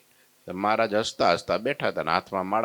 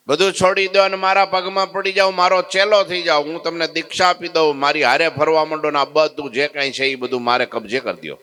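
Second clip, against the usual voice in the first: arrogant commanding shout; 4 semitones higher than usual. Acted. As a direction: boss him around. Sadhu demands submission. (ઘમંડી હુકમ)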